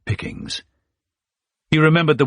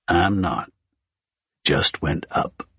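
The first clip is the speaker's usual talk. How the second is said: slow Mississippi drawl; 2 semitones lower, slower than usual. A narrator's reading. Big Tony raising his price, in a light slow Mississippi drawl. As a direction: drop it slow, like a Southern hitman. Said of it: "slow Mississippi drawl".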